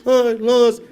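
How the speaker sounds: put-on high, loud, slow child's wail; hammed-up child sob. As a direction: let the tantrum swell. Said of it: "kept getting louder and louder".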